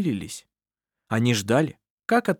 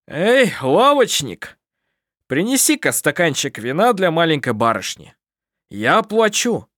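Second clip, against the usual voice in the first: cheerful; 6 semitones higher, 6 dB louder than usual. A narrator's reading. Sevet treating the young lady to wine, cheerful call. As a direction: call out merrily to the shopkeeper. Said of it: "потом весело сказал".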